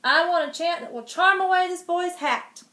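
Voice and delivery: impatient king silencing the magicians; loud royal order. Acted. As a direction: snap it at the magicians. King commanding.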